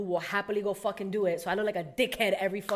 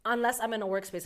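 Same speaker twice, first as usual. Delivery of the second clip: usual speaking voice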